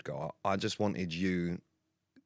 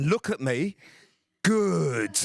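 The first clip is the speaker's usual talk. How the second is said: louder and higher, slow, insistent "Good"; intense gruff coaching voice; 8 semitones higher, 6 dB louder, much slower than usual. Retold or imitated. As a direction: grab his attention and drive the praise home. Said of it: "He was doing this low voice".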